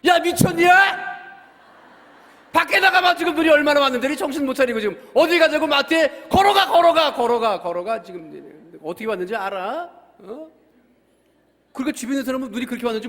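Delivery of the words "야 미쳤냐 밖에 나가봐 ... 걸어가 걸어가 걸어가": furious yelling, much higher and louder; retold angry shouting. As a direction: yell at the son like a father losing his temper. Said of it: "갑자기 소리소리 지르고". (아들에게 버럭 소리 지르는 아빠, 분노의 고함)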